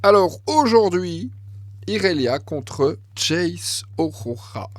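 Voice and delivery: voix d'intro énergique et plus aiguë; intro d'animateur. Acted. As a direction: accueille les spectateurs comme un présentateur. Intro de vidéo YouTube. (ton d'animateur qui lance la vidéo)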